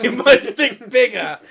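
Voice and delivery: loud, slow caveman through laughter; laughing while playing a dumb ape-man. Repeats ape impression laughing.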